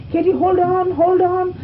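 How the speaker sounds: high, loud calling out; imitating rescuers shouting from behind; retold urgent shout. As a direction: call after someone on a runaway horse. Retold helpers calling to runaway rider.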